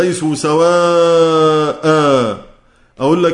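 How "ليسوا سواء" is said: drawn-out Quranic recitation, very slow; slow recitation demo. Shown as a demonstration. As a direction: recite it slowly with the long vowels held. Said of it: "لما تقف مثلًا". (تلاوة بطيئة بمد "ليسوا سواء")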